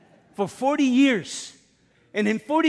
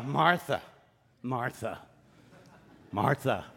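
exasperation; 9 semitones lower, 5 dB quieter than usual. Retold or imitated. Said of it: "That's an exasperation".

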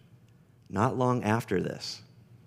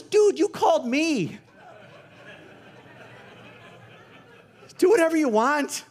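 higher, louder, laid-back dude voice; amused contractor; 6 dB louder than usual. Retold or imitated. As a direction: mimic the amused roofer brushing it off. Contractor's amused reply retold on phone.

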